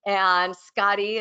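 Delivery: normal talking voice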